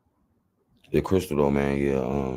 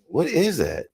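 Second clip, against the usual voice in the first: quoting himself; 11 semitones higher, slower than usual. Retold or imitated. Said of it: "I'm like".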